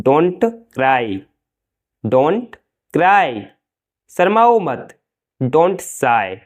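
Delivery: normal talking voice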